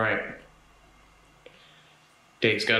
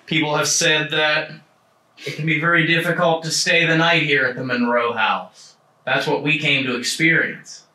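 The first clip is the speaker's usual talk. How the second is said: TV presenter intro; 4 semitones higher, 7 dB louder than usual. Acted. Host's spooky intro to camera.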